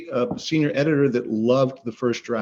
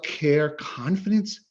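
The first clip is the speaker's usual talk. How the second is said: ordinary talk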